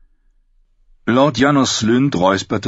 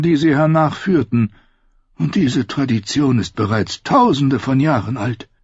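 aged, measured higher voice; cool old maester; 8 semitones higher than usual. A narrator's reading. Maester Aemon explains the Watch's tradition to the king, cool. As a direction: state the tradition calmly. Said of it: "sagte kühl wie immer".

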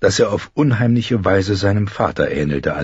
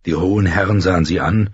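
plain reading voice

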